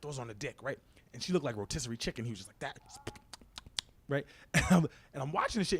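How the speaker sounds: ordinary delivery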